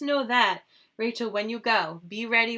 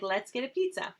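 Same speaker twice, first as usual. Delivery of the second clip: whispered quote; 4 semitones higher than usual. Retold or imitated.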